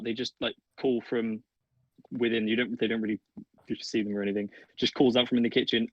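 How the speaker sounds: ordinary talk, nothing marked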